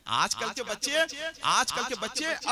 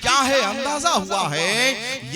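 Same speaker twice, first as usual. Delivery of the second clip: much louder and higher, ending in a shout; shouted recitation; 9 semitones higher, 17 dB louder, slower than usual. Acted. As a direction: hurl the verse at the hall. Couplet declaimed loud. (चीखकर शेर पढ़ना)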